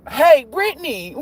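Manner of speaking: put-on high, loud, drawn-out child's call; pestering kid. Stepkid interrupting the movie, imitated as a high pestering call. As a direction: imitate a little kid calling for attention. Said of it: "She talking about".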